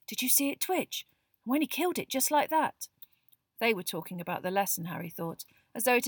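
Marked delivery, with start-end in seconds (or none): 0.1-2.9 high-pitched awed chatter. A narrator's reading. Pupils discuss the curse after the lesson, awed. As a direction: marvel at what you just saw. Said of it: "discussing the curses in awed voices".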